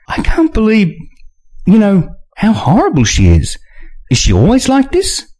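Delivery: put-on very high shocked young woman; Nina voice. A narrator's reading. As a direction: rise high in disbelief.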